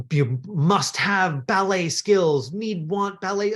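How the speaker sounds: slow, drawn-out list of demands; emphatic demand. Retold or imitated. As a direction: stretch each requirement out for emphasis. Mimics a casting breakdown's demands.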